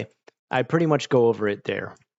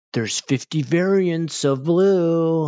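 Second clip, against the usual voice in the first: mocking fussy tone; 5 semitones higher, 4 dB louder, much slower than usual. Mocking fussy color teacher.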